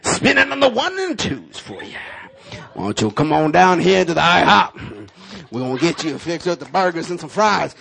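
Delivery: high, loud hyped radio pitch. Retold or imitated. Preacher doing a radio DJ ad for the car wash. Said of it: "with the radio voice".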